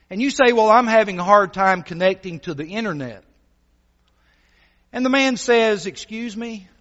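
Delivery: sermon delivery. Acted. Preacher telling the congregation a story, lively preaching. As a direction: preach it with energy, painting the scene.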